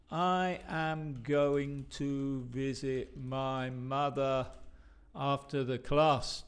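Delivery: slow, every word given full weight; slow and formal. Shown as a demonstration. Teacher models the stiff textbook version, slowly. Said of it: "if we say it like this".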